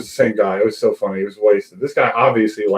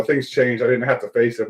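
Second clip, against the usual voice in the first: usual speaking voice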